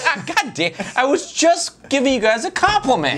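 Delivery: high, laughing mock outrage; laughing protest. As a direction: squeal it out through a laugh. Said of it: "I'm like".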